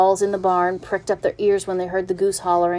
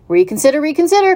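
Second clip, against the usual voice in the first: loud plea, leaping higher; goose's pleading cry; 9 semitones higher, 6 dB louder, faster than usual. A narrator's reading. Goose begging the pig to turn back, rising cry. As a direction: cry out, climbing high on the repeat. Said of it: "cried the goose".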